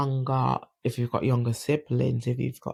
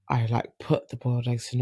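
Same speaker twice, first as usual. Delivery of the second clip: ordinary delivery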